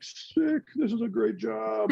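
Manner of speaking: high-pitched mock enthusiasm, tossed off; excited. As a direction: play your younger self thrilled at the gig. Mock quote of own younger self.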